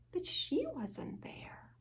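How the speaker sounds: whisper. A narrator's reading. Friends missing, hushed storytelling.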